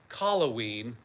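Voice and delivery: ordinary delivery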